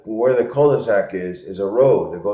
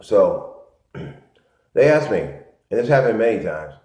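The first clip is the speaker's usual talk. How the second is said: ordinary talk, nothing marked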